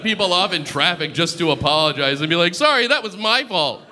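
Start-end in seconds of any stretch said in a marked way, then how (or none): 2.5-3.8 high, chirped-up mock apology; higher-pitched playful "sorry"; pretend apology. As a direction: apologise eagerly in a raised voice. Imagined apology to cut-off driver.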